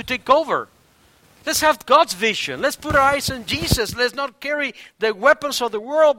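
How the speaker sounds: high, loud, urgent preaching; forceful preaching. Real, not acted. Pastor urging the church to keep eyes on Jesus, forceful preaching. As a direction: exhort the congregation at full voice.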